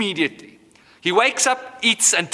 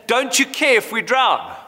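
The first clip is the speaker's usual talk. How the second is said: mock shout; 7 semitones higher than usual. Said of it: "Shouting at Jesus".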